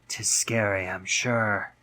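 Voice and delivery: usual speaking voice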